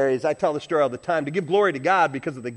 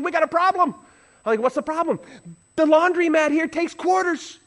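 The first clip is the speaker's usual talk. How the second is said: heated quarrel; 11 semitones higher, 3 dB louder than usual. Retold or imitated. Acted husband and wife quarrel.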